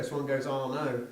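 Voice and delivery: usual speaking voice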